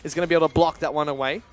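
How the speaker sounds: high, loud live call; excited esports play-by-play. Real, not acted. Caster calling an escape and an ultimate, excited play-by-play. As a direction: ride the fight with urgency.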